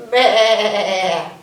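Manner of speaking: loud, wavering baa; long imitated sheep cry; sheep bleat.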